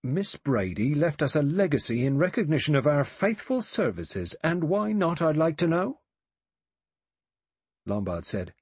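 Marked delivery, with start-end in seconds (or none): 0.0-6.0 loud, stiffly offended dignity. A narrator's reading. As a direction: draw yourself up and bristle. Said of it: "He said stiffly".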